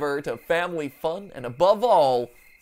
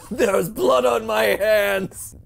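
slow, hammed-up anguished cry; melodramatic; 5 semitones higher, 7 dB louder, much slower than usual. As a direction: stretch it out like tragedy.

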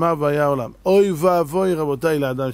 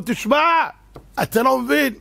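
excited announcement; 8 semitones higher than usual. Retold or imitated. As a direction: burst with news of the win. Excited news at the door. (מבשר בהתרגשות על הזכייה)

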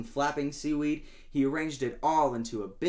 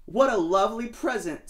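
delighted Sadie; 9 semitones higher, 6 dB louder than usual. Acted. Sadie voiced.

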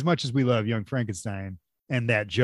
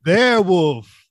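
hammed-up, louder and higher movie line; movie quote; 5 semitones higher, 8 dB louder, slower than usual. Quoting the werewolf gag to a friend, hammed-up. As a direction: draw out the second one, loud and comic.

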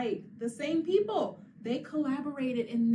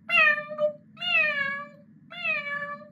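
much higher, louder kitty meow; cat meow; 7 dB louder, much slower than usual. Pretend. Teacher answers her own question with a cat sound. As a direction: meow like a little kitten.

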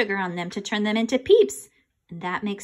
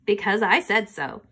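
ordinary delivery, nothing marked

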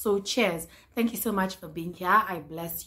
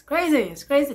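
loud excited crazy; 9 semitones higher, 9 dB louder than usual. Real, not acted. Repeating in disbelief.

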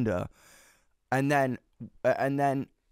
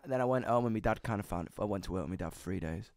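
ordinary delivery

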